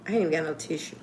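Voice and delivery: soft, choked-up confession; real emotion, quiet and close to crying; near tears. Real, not acted. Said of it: "I'm about to start crying".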